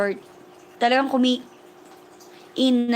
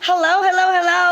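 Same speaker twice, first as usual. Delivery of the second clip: louder and higher, ringing hellos; excited hellos; 8 semitones higher, 6 dB louder than usual. Real, not acted. Welcoming viewers joining the livestream, loud bright hellos. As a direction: call out to the whole chat.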